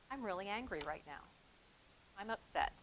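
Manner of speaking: name the anger calmly and quietly. Shown as a demonstration. Adult modelling calm anger for kids, quiet and controlled. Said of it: "say in a quiet tone".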